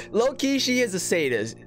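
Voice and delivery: ordinary delivery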